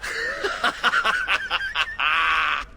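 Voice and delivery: a big, booming laugh at the punchline; hearty guffaw after the punchline. Real, not acted. Zevran laughing at his own joke.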